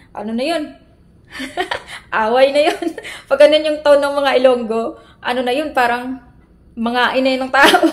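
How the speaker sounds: ordinary talk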